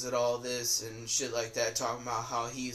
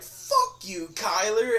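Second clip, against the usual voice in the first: "Fuck you, Kyler" yelled out much higher; 8 semitones higher, 4 dB louder, slower than usual. Retold or imitated. Yelling back at the kid who mocked him, retold yell. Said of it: "I yelled it".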